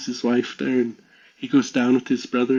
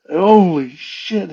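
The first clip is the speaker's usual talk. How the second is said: slow, louder, higher mock shock; retold stunned disbelief; 5 semitones higher, 6 dB louder, much slower than usual. Storyteller voices brother seeing the creature, stunned disbelief. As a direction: draw it out in acted amazement.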